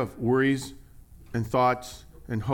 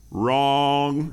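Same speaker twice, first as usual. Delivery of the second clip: a lightly voiced stern dad, louder and final; dad's one-word verdict; 5 dB louder than usual. Pastor imitating his dad shutting down an idea, curt. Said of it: "he had this phrase for me".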